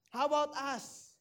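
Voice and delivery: ordinary talk, nothing marked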